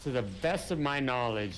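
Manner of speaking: ordinary talk, nothing marked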